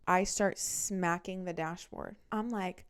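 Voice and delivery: ordinary talk, nothing marked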